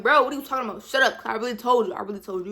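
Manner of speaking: loud retort. Retold or imitated. Arguing back after reveal.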